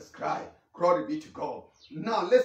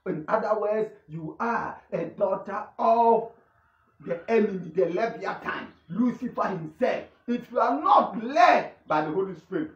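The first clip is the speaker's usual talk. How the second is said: emphatic, declamatory sermon delivery; forceful preaching; 3 semitones higher, 4 dB louder than usual. Real, not acted. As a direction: preach it with conviction, driving each phrase home.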